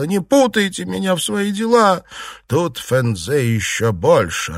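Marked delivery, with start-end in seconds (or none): 0.0-2.0 high-pitched tearful protest; upset, raised-pitch exclamation; tearful cry. A narrator's reading. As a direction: cry out in distress, pitch jumping up. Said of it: "плача, вскричала".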